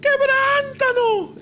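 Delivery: very high, slow, eerie wail; voice from beyond the grave. Retold or imitated. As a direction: stretch it out like a spirit moaning. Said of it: "se oyó una voz de ultratumba".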